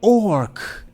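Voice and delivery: loud, high shout; proclaiming. Acted. Ogre chief announcing the chosen fighter, loud proclamation. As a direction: bellow the choice to the arena.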